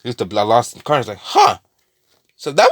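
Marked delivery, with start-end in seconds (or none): 1.2-1.6 very high curious huh. Retold or imitated. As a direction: react with mild curiosity. Connor's curious reaction.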